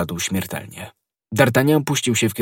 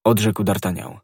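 usual speaking voice

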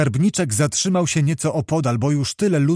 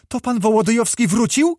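high, rising exclaimed question; excited cry; 7 semitones higher than usual. A narrator's reading. Anusia hears of Wołodyjowski's return, high excited cry. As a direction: exclaim it high, lifting sharply at the end. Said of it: "krzyknęła Anusia".

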